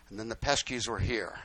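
ordinary delivery

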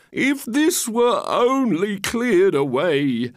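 slow, high, stretched-out lament; wistful grand characters. Acted. Walrus and carpenter lament the sand, slow and wistful. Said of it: "they said".

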